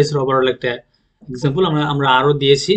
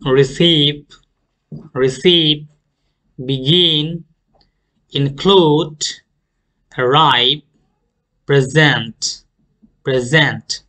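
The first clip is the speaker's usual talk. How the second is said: receive, begin, include punched on CEIVE, GIN, CLUDE; light start, strong second syllable on arrive and present; second-syllable stress; much slower than usual. Shown as a demonstration. Teacher models two-syllable verbs, second-syllable stress. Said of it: "stress করব second সিলেবলে".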